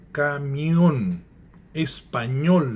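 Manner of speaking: caminhão, espanhol loud, each ending drawn out and stressed; stress on last syllable. Shown as a demonstration. Teacher giving examples, "caminhão, espanhol" stressed on the last syllable. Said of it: "a última sílaba é que é tonificada".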